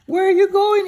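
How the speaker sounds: high, loud cartoon scarecrow; storyteller voicing a high silly scarecrow. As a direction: pitch way up and call out as the scarecrow. Scarecrow asks Dorothy.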